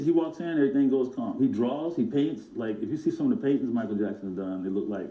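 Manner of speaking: normal talking voice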